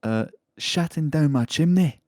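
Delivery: high-pitched mock Scottish; attempted Scottish accent. Host reads the neighbour story in a high, mock Scottish accent. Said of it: "Scottish thing to say".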